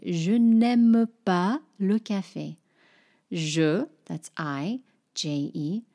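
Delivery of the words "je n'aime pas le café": slow, careful French model sentence; French demo. Shown as a demonstration. French teacher models a sentence for learners, slow and clear. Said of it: "I would say".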